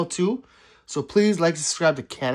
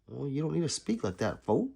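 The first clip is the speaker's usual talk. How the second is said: ordinary talk, nothing marked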